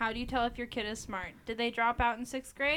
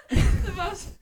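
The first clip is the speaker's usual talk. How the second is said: laughing; 8 semitones higher, 9 dB louder than usual. Real, not acted. Laughing mid joke.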